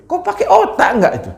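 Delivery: put-on preacher scolding a father who shouts at his child; scolding question.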